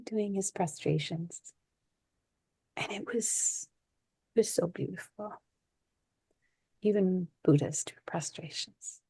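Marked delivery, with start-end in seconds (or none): 2.8-5.3 soft, nearly whispered awe; hushed. Real, not acted. Remembering the old teacher at his prostrations, hushed. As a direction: hush your voice as if not to disturb him. Recalling seeing him do prostrations.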